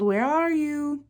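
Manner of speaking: high, drawn-out call; calling out, slowly. A narrator's reading. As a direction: stretch it out searching. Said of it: "Flounder called".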